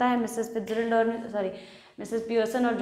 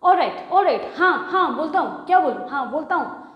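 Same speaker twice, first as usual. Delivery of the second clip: loud, higher, fed-up snapping back; loud irritated husband; angry retort; 4 semitones higher, 5 dB louder than usual. Acted. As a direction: bite back at the wife. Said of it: "George कहता है गुस्से में".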